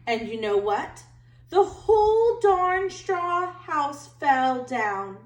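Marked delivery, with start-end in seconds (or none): 1.5-5.2 slow, louder, drawn-out announcement; milk the collapse for effect; dramatic reveal. A narrator's reading. Wolf telling how the straw house fell.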